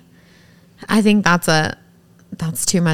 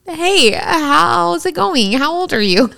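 rehearsed pickup line; 8 semitones higher, slower than usual. Retold or imitated. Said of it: "going over in my head how to ask him".

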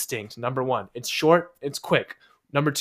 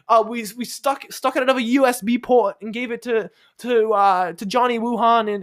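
high, hammed-up British accent as a future prime minister; 9 semitones higher than usual. Podcast host playing a future British prime minister, hammed-up British accent. Mock British PM character bit.

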